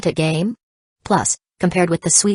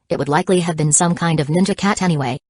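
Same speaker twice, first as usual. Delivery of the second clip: usual speaking voice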